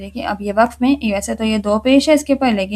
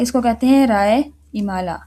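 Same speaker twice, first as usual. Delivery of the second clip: ordinary talk, nothing marked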